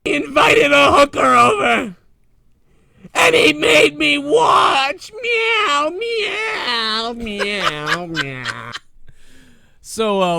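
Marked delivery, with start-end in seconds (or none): 0.0-5.1 much higher, louder hammed-up wail, slow at the end; mock cat sobbing. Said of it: "What the fuck was that?". 5.2-8.7 very high shrieking meows sliding down into a laugh; mock crying meows. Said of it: "What the fuck was that?".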